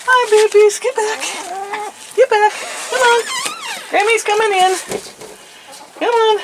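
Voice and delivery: very high, sing-song coo. Real, not acted. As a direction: greet the bunnies like a doting grandma. Greeting baby rabbits entering pen.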